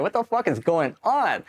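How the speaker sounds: mock his own disbelief at the chaos; baffled. His own baffled reaction retold.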